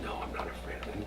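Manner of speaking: faint, unvoiced whisper of a dying man; soft whisper. Zusia on his deathbed answering disciples, soft whisper. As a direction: whisper it calmly.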